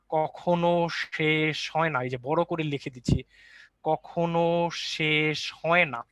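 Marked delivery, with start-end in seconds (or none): 0.1-2.0 very slow, stretching each word; drawn-out emphasis. Shown as a demonstration. Teacher hammers home key rule. 3.8-6.0 extremely slow, long-held vowels; drawn-out emphasis. Shown as a demonstration. Repeats rule while writing it big.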